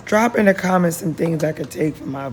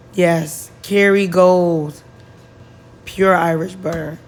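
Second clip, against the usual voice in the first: proud praise; 8 dB louder, slower than usual. Real, not acted. Praising favourite butter.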